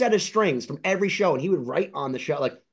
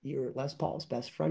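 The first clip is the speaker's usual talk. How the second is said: soft, much quieter echo of his words; stunned echo; 3 semitones lower, 10 dB quieter than usual. Retold or imitated. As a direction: repeat it back, hushed in disbelief. Own disbelieving echo of Jimmy.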